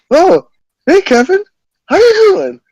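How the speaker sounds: put-on high, loud, overly cheery drunk hello; drunk roommate greeting. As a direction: play the caught roommate acting casual and friendly. Drunk roommate greets him mid-act.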